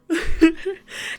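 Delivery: high excited squeal; excited squeal laugh. Real, not acted. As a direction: squeal with delight. Fall is here.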